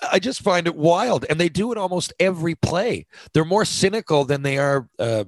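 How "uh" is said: ordinary talk, nothing marked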